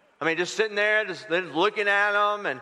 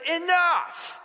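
sharp enough; 7 semitones higher than usual. Retold or imitated. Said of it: "he just says".

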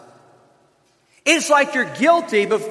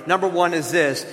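normal talking voice

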